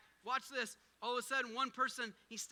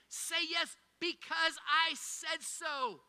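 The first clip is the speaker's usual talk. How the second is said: loud, high and very slow; emphatic God voice; 6 semitones higher, 5 dB louder, much slower than usual. Acted. God insisting to the congregation, loud and slow. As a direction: drag out every word with authority. God's voice, preaching climax.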